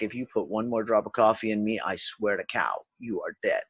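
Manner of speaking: lightly voiced warning, low and quiet; low, deadpan threat from the stomach; stomach talking. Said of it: "your stomach is like".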